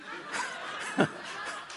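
high unvoiced giggle; real laugh. Real, not acted. Preacher laughs at his own joke about cats. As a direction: chuckle at your own cat joke.

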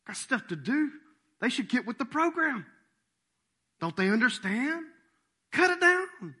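high-pitched exasperated complaint; graceless impatience. Acted. Busy vineyard owner dismissing the fig tree, exasperated. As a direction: build to a loud, high, exasperated order. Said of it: "but no grace".

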